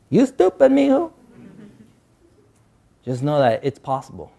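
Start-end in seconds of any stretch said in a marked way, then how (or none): 0.1-1.1 higher, mock-scolding grandma. Grandma scolding her grandson, voice higher. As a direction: play grandma chiding him fondly. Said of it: "And she would say".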